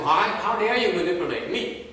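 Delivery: loud, high mock indignation; upset. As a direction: act out an affronted student demanding an answer. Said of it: "then you get upset and say".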